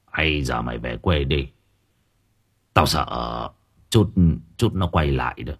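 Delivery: very low, soft, hesitant advice; concerned old worker. A narrator's reading. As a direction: urge him quietly, with worried pauses. (ông già lo lắng, khuyên khẽ)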